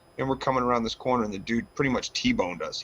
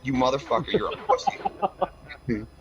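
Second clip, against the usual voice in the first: higher, indignant taunt; retold insult; 5 semitones higher, slower than usual. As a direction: hurl an insult to provoke him. Retells insulting the driver.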